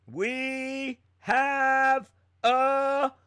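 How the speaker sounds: high, loud, stretched-out winner cry; shouted announcement. Real, not acted. Host announcing the giveaway winner, loud shout. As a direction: belt it like a game show host.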